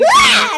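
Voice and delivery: very high, loud shriek; terrified scream. Acted. Jenny Ann screams at the hand from the phone. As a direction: scream and fling it away. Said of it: "she's like".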